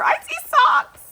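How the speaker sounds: high, loud burst of excitement; thrilled squeal. Real, not acted. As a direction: squeal at the discovery. Spots socks in the parcel.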